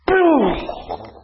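a high, loud mock "boom"; imitated ball boom. Describing the ball flying off the tee, a high mock boom. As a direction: make the ball launch sound big.

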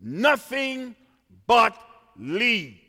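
usual speaking voice

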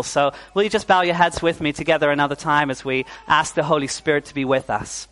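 ordinary talk

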